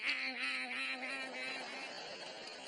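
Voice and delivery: repeated imitated "ah" cries, fading away; comic string of "ah" noises, trailing off; emoticon noises. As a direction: voice a row of silly emoticons. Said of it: "then emoticons. They're like".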